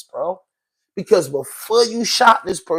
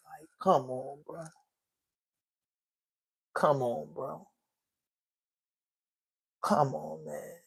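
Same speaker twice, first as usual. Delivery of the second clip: much softer, lower, drained; heartbroken murmur; 3 semitones lower, 16 dB quieter than usual. Real, not acted. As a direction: barely get it out, crushed. Overwhelmed by the boy's death.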